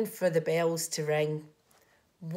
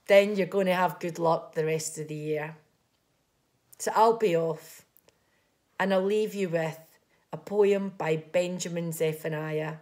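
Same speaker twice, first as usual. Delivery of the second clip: ordinary delivery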